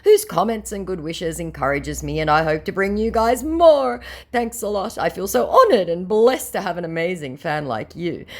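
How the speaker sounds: lightly mock his stiff English; mock scammer voice. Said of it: "say it with me".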